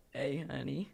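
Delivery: low raspy smoker's rasp; husky chain-smoker greeting. Pretend. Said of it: "I do a really good smoker's voice".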